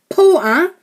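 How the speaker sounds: high, loud summons; calling out. A narrator's reading. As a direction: call sharply for the porter. Boy calls out to porter.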